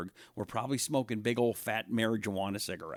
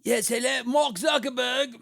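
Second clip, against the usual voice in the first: George Soros impression; 11 semitones higher than usual. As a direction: shout it like a cartoon schemer calling his buddy. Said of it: "That's my George Soros impression".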